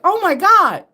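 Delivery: loud high mock gasp; shocked husband. Husband amazed she just knew, loud high gasp. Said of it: "he was shocked today when he's like".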